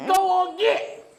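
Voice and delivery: high, loud country holler; hollered chasing-off. Acted. Country character running someone off, hollering high and loud. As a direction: shoo them like a stray animal.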